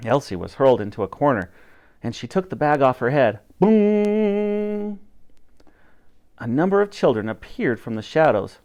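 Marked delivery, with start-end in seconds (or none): 3.6-5.0 higher, held boom; booming sound. A narrator's reading. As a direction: let the boom ring out. Sound effect in story.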